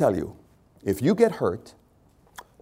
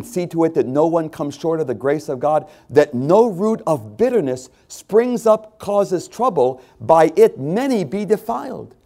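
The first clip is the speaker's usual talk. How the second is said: preached scripture; 6 semitones higher, 5 dB louder than usual. Acted. Reads scripture with force.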